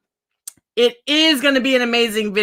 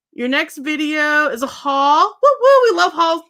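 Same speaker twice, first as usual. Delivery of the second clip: excited; 5 semitones higher, 3 dB louder than usual. Real, not acted. Cheering a viewer's upcoming haul.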